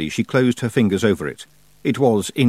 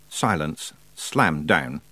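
ordinary delivery, nothing marked

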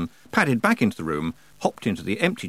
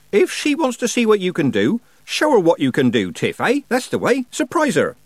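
high, loud, rousing Nanny voice; Nanny Ogg, egging on; 10 semitones higher, 5 dB louder than usual. Acted. Nanny urges Tiffany to show her, rousing. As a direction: cheer her on with gusto, pitched high.

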